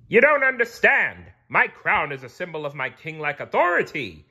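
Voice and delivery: high, loud royal protest; loud, high-pitched kingly outburst; indignant king. A narrator's reading. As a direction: insist on your authority, loudly. King Neptune protests to SpongeBob.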